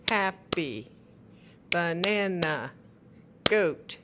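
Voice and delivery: slow, each syllable punched loud; syllables clapped out. Shown as a demonstration. Parent modelling syllable clapping, slow and punched. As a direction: tap out every syllable. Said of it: "clap every time they hear a part".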